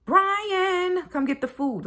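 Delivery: high, drawn-out name, then the summons; dinner-call yell. Acted. Parent calls a child to come eat, unangered yell. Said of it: "yelling to tell your kids that dinner is ready".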